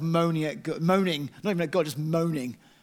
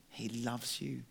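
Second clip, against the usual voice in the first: much softer, lower and gentle; hushed loving assurance; tender; 5 semitones lower, 12 dB quieter than usual. Real, not acted. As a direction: reassure them quietly. Tender closing reassurance.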